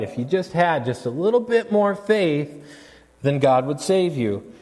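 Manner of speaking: high, lightly mocking pious tone; preacher mimicking a trite pep-talk; mock preachy platitude. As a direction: parody a glib faith cliché. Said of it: "the thing that I don't wanna do is tell you".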